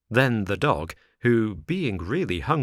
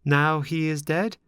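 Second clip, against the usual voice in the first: sorrowful interruption; 7 semitones higher than usual. A narrator's reading. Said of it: "Pinocchio interrupted sorrowfully".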